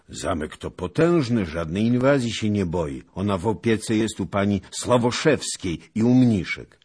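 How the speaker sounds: put-on lively, insistent old man; emphatic assurance. A narrator's reading. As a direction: assure him firmly that she is safe. Beggar reassures the knight proudly.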